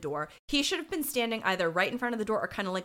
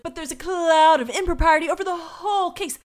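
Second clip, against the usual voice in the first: mock attorney; 7 semitones higher, 6 dB louder than usual. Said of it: "Sal's attorney's like".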